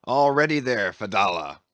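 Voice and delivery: loud captain's call across the deck; commanding hail; cried out. A narrator's reading. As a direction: call it out to the old man at the boat. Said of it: "Ahab cried out".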